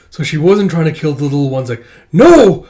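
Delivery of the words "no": loud high cry. Acted. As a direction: shout it in horror. Cry as child attacked.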